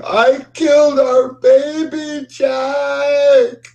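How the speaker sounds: very high, slow, wailing cry; sobbing scream. Acted. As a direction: confess it in a ghostly sobbing wail. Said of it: "She screams out, sobbing".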